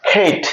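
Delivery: "খেইট" with a puff of air on the k; long gliding ey in "খেইট"; aspirated K demo. Shown as a demonstration. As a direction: release the k of "খেইট" with breath. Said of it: "এরকম ভাবে".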